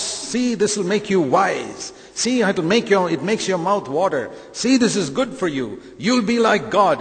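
the devil in Eden, luring Eve with a sales pitch; coaxing devil. Acted. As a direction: sell it like a sly tempter.

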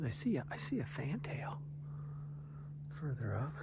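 hushed whisper so the bird stays. Real, not acted. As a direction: whisper it softly. Spotting a bird quietly.

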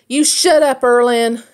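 loud angry yell from inside the fort; furious sister shouting. A narrator's reading. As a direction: yell it at your brother. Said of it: "she shouted at him".

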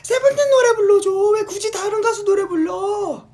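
high, loud, drawn-out whine; slow high whining demand; whiny demanding fan. Retold or imitated. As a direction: nag the idol like a spoiled superfan. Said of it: "이런 느낌".

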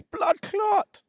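very high, slow stretched yell; hammed-up stretched shout; mock shout. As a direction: belt the catchphrase in a put-on voice. Mocking the stolen catchphrase.

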